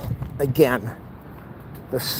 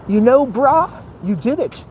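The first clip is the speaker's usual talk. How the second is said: lightly put-on dude voice, higher; self-congratulation; 8 semitones higher than usual. Walker congratulating self afterwards, higher playful voice. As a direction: congratulate yourself in a raised voice. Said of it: "I'm gonna say".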